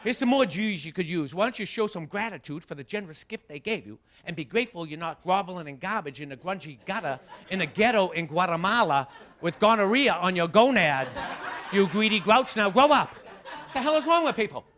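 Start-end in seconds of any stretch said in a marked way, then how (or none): 5.0-13.2 loud, slowing tirade ending in a shout; ranting scold. Acted. Comic scolding the ungrateful kid, rising loud tirade. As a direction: pile the insults on, louder to the end.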